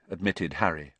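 ordinary delivery

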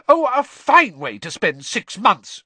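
loud, high, slow shriek. A narrator's reading. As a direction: shriek with sarcastic fury. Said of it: "she shrieked".

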